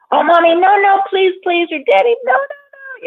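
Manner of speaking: very high, frantic little-one begging; imitated pleading child. Host imitating little ones taken out by parents, high pleading. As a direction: plead like a small child being taken away. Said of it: "little ones, you know, they'd be taken".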